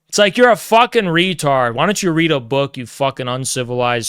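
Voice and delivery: ordinary talk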